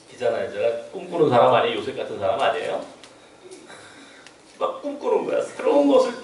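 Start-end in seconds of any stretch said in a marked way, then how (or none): none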